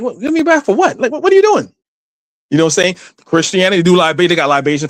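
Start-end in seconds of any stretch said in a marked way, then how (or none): 0.0-1.7 high, disbelieving questions; mock baffled son refusing; pitched-up shocked objection; incredulous protest. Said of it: "I'm like, I'm like".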